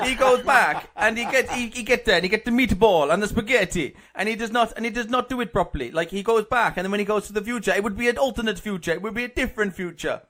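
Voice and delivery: hammed-up Italian accent, earnest arguing; comic Italian-accented complaint. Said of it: "Thirty seconds in Italian".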